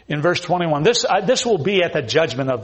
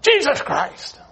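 a higher exclamation of "Jesus Christ"; mock coworker yell; 11 semitones higher, 3 dB louder, slower than usual. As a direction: toss it out like a careless curse. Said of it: "a coworker yells out".

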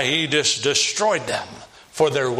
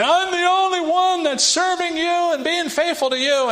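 much higher, whiny self-pitying moan; complaining Elijah; 3 dB louder than usual. Retold or imitated. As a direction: whine it like a martyr nobody thanks. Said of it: "he starts complaining".

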